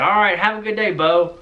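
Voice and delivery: high-pitched dad impression signing off; Barry. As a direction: imitate a father ending a phone call. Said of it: "I think he said".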